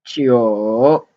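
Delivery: dipping Mandarin tone on Jiu; third tone. Shown as a demonstration. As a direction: swing Jiu down and back up. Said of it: "in third tone".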